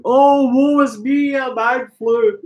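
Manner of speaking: high, theatrical woe-is-me wail; mock self-pity. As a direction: ham up the suffering of a sick man. Mocking his own man flu.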